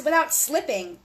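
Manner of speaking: usual speaking voice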